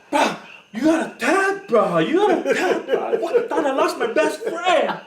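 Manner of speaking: high, loud, laughing retold scold. Relieved training partner scolding his friend for not tapping, laughing yell.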